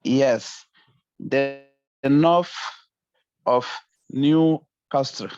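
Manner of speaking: usual speaking voice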